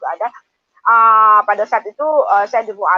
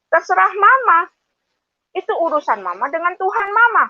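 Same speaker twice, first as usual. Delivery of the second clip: husband's reply; 8 semitones higher than usual. Retold or imitated. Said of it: "suami saya ngomong begini".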